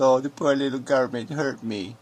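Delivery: lightly voiced whining mimicry; a hint of a sniveling victim; mock whine. Mocking the commenter's complaint in a light whiny voice. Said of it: "you just whined about your little".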